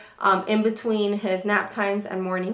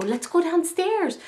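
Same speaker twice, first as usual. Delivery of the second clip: put-on higher, slower sing-song coax to a toddler; coaxing mom voice; 7 semitones higher than usual. Mother coaxing her toddler downstairs, sweet sing-song. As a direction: lure the little one gently downstairs. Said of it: "snuggles and I go".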